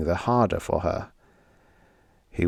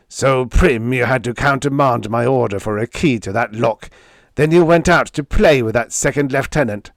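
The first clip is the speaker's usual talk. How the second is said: higher, louder accusing tirade; unrelenting abuse; 4 semitones higher, 5 dB louder than usual. A narrator's reading. Jealous man abuses his fiancée over her outing, relentless tirade. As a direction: hurl the accusations without letup. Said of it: "Narf's unrelenting abuse".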